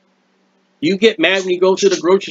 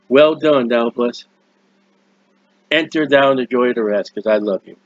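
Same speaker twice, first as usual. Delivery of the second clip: a touch of a tender heavenly father welcoming a son; voice of God; 5 semitones lower than usual. Acted. God greeting the faithful servant at heaven's gate, gently lower.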